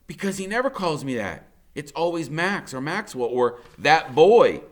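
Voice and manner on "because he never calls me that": plain reading voice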